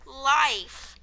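slow, separated words, a long pause between; slow enunciation. Real, not acted. Clarifying for a confused friend, slowly enunciated. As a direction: spell it out word by word.

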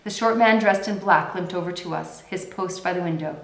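ordinary delivery

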